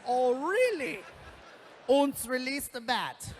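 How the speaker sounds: put-on lightly accented sinister line; mock German villain. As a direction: tease like a cartoon villain.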